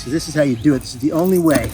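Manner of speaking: ordinary talk, nothing marked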